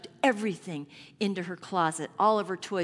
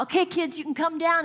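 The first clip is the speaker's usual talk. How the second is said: loud, high call down the stairs; 9 semitones higher, 7 dB louder than usual. Retold or imitated. Mother calling her kids down to family court, loud and high. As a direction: summon the kids in a raised voice.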